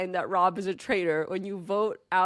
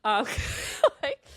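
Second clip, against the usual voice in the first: ordinary talk, nothing marked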